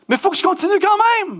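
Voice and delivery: high mock wail of protest; exasperated outburst. Servant of God grumbling about dreadful places, exasperated outburst. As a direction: cry it out high, half-laughing.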